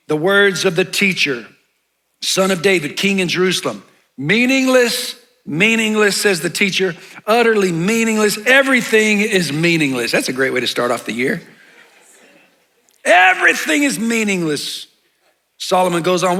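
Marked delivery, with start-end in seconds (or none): 0.1-10.1 raised, emphatic public reading voice; scripture reading. Acted. Pastor reading Ecclesiastes to his congregation, declamatory scripture reading. 13.0-14.9 scripture reading. Acted. Pastor repeating Solomon's bleak verse to congregation, dramatic declamation.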